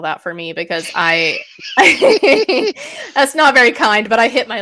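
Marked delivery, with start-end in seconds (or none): 1.4-3.1 a high, loud burst of laughter; real sudden laugh. Real, not acted. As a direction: laugh out at yourself, caught out. Bursts out laughing at own confession.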